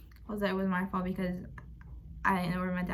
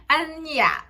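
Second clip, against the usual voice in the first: normal talking voice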